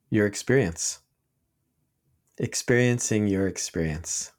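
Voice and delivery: unhurried meditation guide with long pauses; guided meditation. Real, not acted. Teacher leading listeners in sitting practice right now, calm guided meditation. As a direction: ease listeners into noticing, leaving long silences between short phrases.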